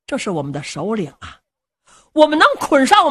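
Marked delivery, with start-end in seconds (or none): none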